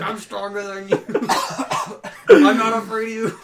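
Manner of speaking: defiant movie character. Acted. Friend as the man on the balcony facing Godzilla, mock defiant. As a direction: play a hammed-up doomed man challenging the monster.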